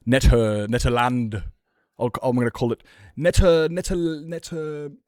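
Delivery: Netter- said loudly, clipped off; deliberately butcher the Netherlands as Netter-; loud playful mispronouncing; mock mispronunciation. Shown as a demonstration. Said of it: "what if I pronounce the Netherlands".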